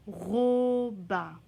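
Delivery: "Robin" loud and drawn out, ro then bin; "Robin" stretched for listeners to hear the first syllable; sounded-out word. Shown as a demonstration. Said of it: "Entends-tu ro au début du mot".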